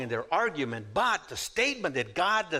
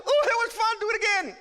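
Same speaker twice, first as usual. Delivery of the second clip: put-on loud, squealing high kid voice; exaggerated high-pitched childish glee; gleeful child; 6 dB louder, faster than usual. Voices child enjoying a spanking.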